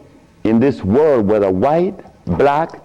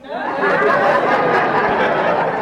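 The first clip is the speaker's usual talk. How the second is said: laugh. Real, not acted. Laughing at own joke.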